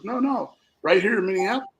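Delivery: high, insistent no no; eager correction. Retold or imitated. Lawyer correcting the speaker over the meeting place, pitched high and eager. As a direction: cut him off, pitched well up and eager. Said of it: "And he goes".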